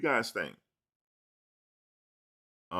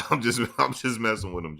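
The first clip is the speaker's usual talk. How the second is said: laughing while talking, a bit louder; teasing laughter; 4 semitones higher, 5 dB louder than usual. Real, not acted. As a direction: laugh it off as a tease.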